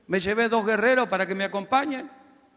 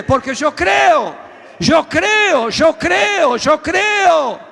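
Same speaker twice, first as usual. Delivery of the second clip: shouting, higher and louder, each line hammered; roaring revival preacher; preaching at full force; 5 semitones higher, 6 dB louder than usual. Acted. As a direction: fire up the congregation. Preaching climax.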